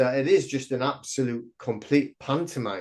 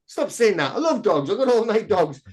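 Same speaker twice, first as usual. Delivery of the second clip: higher-pitched imitation of an offended woman; mock indignant protest; 7 semitones higher than usual. Chinese woman denying she eats dogs, mock indignant. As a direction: protest like someone wrongly accused, pitch lifted. Voicing woman defending herself online.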